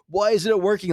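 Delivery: mock hissy fit. Front man smacking his mic on the speakers, mock tantrum. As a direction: imitate a whiny tantrum, pitch jumping up. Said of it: "throwing a hissy fit".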